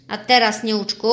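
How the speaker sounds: loud, stern call to the pupil; a strict teacher snapping to attention. Acted. Strict mistress sternly addressing the truant elf. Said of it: "powiedziała surowo mistrzyni".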